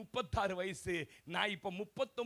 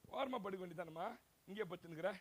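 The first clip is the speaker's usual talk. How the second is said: much softer, offhand son's brush-off; dismissive son; 4 semitones lower, 10 dB quieter than usual. Retold or imitated. Son brushing off his tired mother, softly dismissive. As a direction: toss it off like a careless son.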